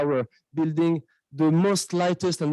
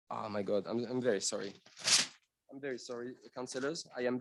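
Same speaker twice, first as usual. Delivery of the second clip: flustered apology; 4 semitones lower, 14 dB quieter, faster than usual. Real, not acted. Flustered speaker apologizes to councillors.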